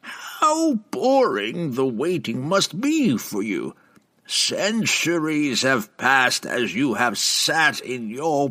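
slow, high taunting witch; teasing. Acted. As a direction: taunt him with false sympathy. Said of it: "Benray teased".

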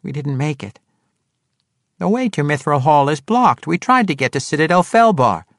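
high, insistent halfling voice; explaining halfling. A narrator's reading. Halfling explains the blocked way to the swordswoman, insistent. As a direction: pitch it up and plead your case.

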